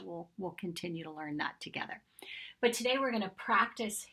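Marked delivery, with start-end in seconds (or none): 0.0-2.0 whispered. Real, not acted.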